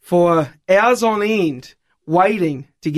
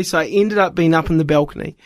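normal talking voice

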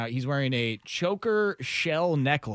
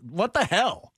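mock disbelief; 7 semitones higher, slower than usual. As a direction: pretend outrage at the fan. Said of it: "Like, dude".

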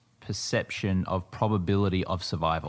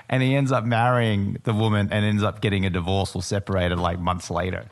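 normal talking voice

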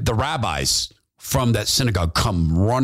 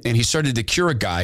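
ordinary talk, nothing marked

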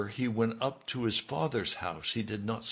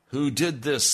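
a loud, higher demand to know who did it; 5 semitones higher, 8 dB louder than usual. Retold or imitated. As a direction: bark out the question like an angry ruler. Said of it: "demanded to know".

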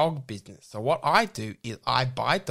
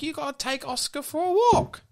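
nagging mum; 11 semitones higher than usual. Retold or imitated. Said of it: "she's like".

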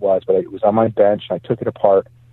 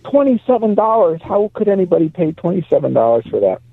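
incredulous quote; 7 semitones higher than usual. Retold or imitated. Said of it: "my employee said".

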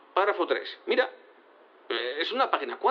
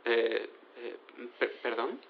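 low, soft, halting; bewildered, hesitant query; flustered stammer; 8 semitones lower, 7 dB quieter than usual. Acted. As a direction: stumble quietly over the word, thrown off. Lawyer interrupted by unexpected revelation.

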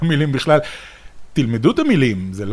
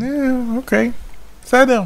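high, surprised resignation; oh, okay, fine, said high and resigned; 10 semitones higher than usual. Real, not acted. Reacting to disappointing winner.

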